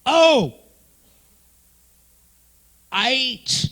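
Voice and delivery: loud, high mock chant of "Oh, I H"; a hint of Nate's crowd call; imitating Nate. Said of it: "Nate opened it up with".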